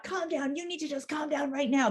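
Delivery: high, sharp dismissive command; mock scolding. Shown as a demonstration. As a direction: demo the wrong, bossy way. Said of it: "So not".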